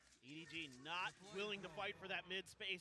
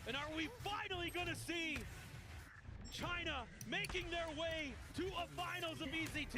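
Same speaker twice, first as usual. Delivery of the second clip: higher excited call; excited caster; 8 semitones higher, 3 dB louder than usual. Real, not acted. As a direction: build the finals hype.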